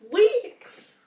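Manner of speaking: high, loud cry of disbelief; incredulous shout. Real, not acted. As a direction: yelp the word in shock. Disbelief at the neighbor's message.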